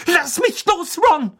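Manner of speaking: high, furious cry; aufgebracht. A narrator's reading. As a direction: shriek at him to let go. Hermine fights off Ron's grip. (schrill und wütend gerufen)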